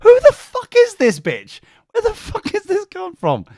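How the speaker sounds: hammed-up outraged squeal; indignant disbelief. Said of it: "And I'm like".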